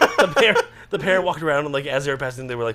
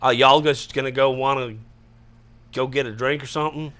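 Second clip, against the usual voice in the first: Southern accent; 3 semitones lower than usual. Shown as a demonstration. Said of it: "start doing a southern accent".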